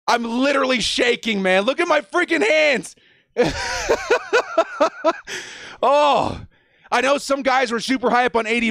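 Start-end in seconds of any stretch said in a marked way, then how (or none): 3.4-5.4 high rapid-fire laughter; giddy laugh. Real, not acted. Fan shaking after the pick, giddy laugh.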